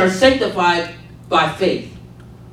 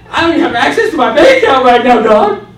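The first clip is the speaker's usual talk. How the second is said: loud, higher-pitched mock pleading outburst; exasperated protest; 4 semitones higher, 7 dB louder than usual. Preacher protesting to God about his bank account, exasperated. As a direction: burst out pleading, comically panicked.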